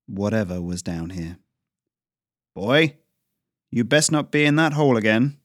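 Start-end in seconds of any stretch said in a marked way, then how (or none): 2.5-5.4 loud stern shout from the house; father's shout. Acted. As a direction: call the boy out of the hole, loud and stern. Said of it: "his father's shout rang out".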